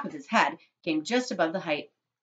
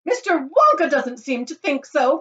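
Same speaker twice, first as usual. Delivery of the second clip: high, loud, drawn-out accusation; indignant cry; 10 semitones higher, 11 dB louder than usual. A narrator's reading. Mother accuses laughing chocolate maker, indignant cry. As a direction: cry out in outrage. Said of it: "cried Missus Gloop".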